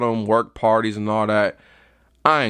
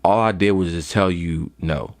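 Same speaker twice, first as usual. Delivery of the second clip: ordinary talk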